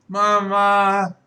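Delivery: put-on high, loud little boy calling his mother; toddler. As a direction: whine for mama like a two-year-old. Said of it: "He's like".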